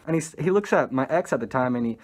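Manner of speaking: ordinary talk, nothing marked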